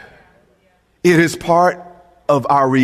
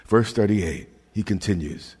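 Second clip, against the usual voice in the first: ordinary talk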